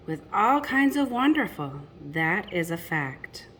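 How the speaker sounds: lilting rhymed storybook read; reading to children. A narrator's reading. Parent reading a picture book to children, lilting rhyme. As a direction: read the rhymes to the little ones.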